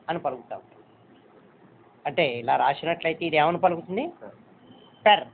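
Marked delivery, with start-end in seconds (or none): none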